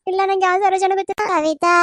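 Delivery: ordinary talk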